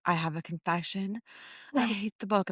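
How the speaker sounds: imitating a reluctant confession, softly; hushed confession. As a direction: own up quietly, as if embarrassed. Retold reluctant confession by friend.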